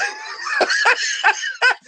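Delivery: sudden high laugh breaking out; high burst of laughter. Real, not acted. Host laughing hard at a viewer's chat joke. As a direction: crack up at the comment.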